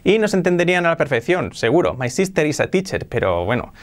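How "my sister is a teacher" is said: understandable but heavily Spanish sounds; learner's Spanish accent, tight i and tapped r; Spanish-accented English. Shown as a demonstration. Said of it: "no tendrías mucha carisma hablando así".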